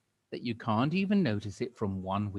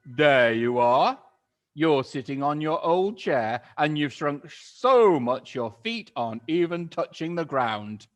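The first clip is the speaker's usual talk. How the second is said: mock shout; 5 semitones higher, 10 dB louder than usual. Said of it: "pointed at her and shouted".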